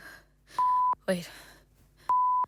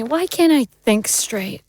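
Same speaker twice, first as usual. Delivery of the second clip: normal talking voice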